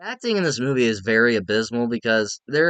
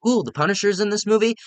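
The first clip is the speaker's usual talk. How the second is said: higher-pitched, intrigued ooh; excited reaction; 8 semitones higher than usual. Retold or imitated. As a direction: re-enact a pleased surprise at spotting someone. Reacting to seeing actor's name.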